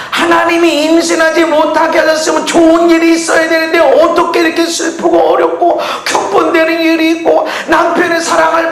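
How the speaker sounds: high, loud impassioned preaching; preaching at full force. Acted. Preacher pleading Hannah's grief to the congregation, impassioned. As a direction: cry it out to the congregation.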